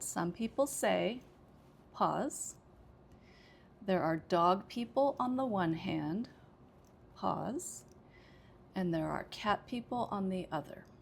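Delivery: each thought group read apart with a pause; pause marked out. Shown as a demonstration. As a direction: say it phrase by phrase, pausing.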